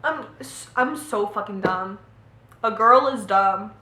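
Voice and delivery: ordinary delivery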